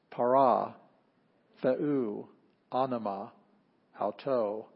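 slow word-by-word Greek recitation, each word set apart; reading Greek aloud. Shown as a demonstration. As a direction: read the Greek text aloud with the class, one word at a time. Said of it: "Can we read it together instead of pronouncing after me".

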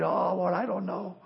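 a frightened soul barely voicing doubt; hushed, half-hearted worried plea; fearful prayer. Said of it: "you're still afraid".

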